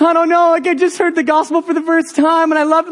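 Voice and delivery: high-pitched imitation of a weepy teen; hammed-up emotional teen, pitched high; tearful teenage girl. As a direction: retell it as an overwhelmed crying girl. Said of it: "who's crying over there".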